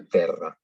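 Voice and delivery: terra con rr vibrante fuerte; rr doble. Shown as a demonstration. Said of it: "solamente decimos R cuando está doble". (terra con r múltiple)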